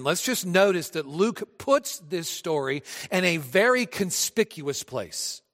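usual speaking voice

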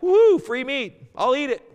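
high, loud, slow gleeful whoop; hammed-up delight at free meat; mock glee. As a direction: play the careless eater grabbing free food. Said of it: "You're like".

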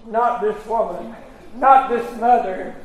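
normal talking voice

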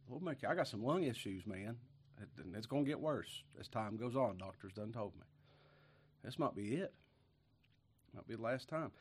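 ordinary delivery

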